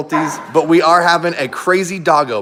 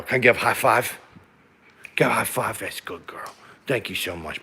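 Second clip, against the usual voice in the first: tender dog praise; 7 semitones lower, 4 dB quieter than usual. Real, not acted. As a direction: coo at the dog like a princess. Owner praising husky doing tricks.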